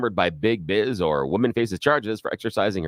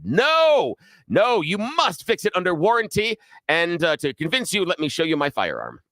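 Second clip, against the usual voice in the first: much higher, hammed-up threat; mock menace, pitch flying up on no; threatening customer; 6 semitones higher than usual. Host voicing the customer threatening the repairman with her gun, higher and hammed up. Armed customer voiced in story.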